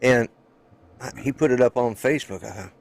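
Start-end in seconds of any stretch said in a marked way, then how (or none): none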